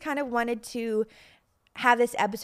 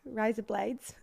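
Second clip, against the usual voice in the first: tentative, softer mock Australian accent; 6 dB quieter, slower than usual. Shown as a demonstration. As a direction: try out an Australian accent, softly. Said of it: "Try a Australian accent".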